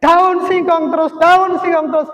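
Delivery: loud-ish, high-pitched mock complaint; acted whine of a fed-up child; grumbling child. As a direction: imitate a sulky kid griping at dinner. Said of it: "langsung mengomel".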